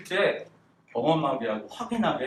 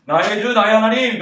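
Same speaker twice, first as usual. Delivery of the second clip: overwhelmed exclamation; 6 semitones higher, 9 dB louder than usual. Shown as a demonstration. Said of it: "너무너무 감격해가지고".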